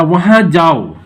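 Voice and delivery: "वहाँ" stressed with a falling tune; emphatic order. Shown as a demonstration. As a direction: give the order firmly, leaning on "वहाँ". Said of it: "हम जोर दे के बोलते हैं". ("वहाँ" पर ज़ोर देकर आदेश)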